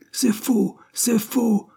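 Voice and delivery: screaming it's not true; howled denial. A narrator's reading. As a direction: yell the denial in outrage. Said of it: "hurla-t-il".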